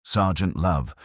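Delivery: ordinary delivery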